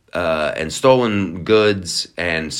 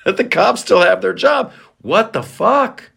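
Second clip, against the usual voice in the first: mock outrage; 11 semitones higher, 3 dB louder than usual. Mocking outraged people.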